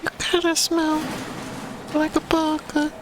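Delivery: squeaky anime voice. Pretend. Silly high voice gag.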